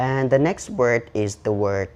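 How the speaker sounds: ordinary talk, nothing marked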